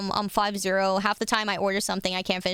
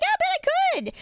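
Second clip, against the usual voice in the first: high cocky boast. Retold or imitated. Tomboy daring the boy at spaghetti night, squeaky high boast. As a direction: squeal the dare like a cocky kid. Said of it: "but I was like".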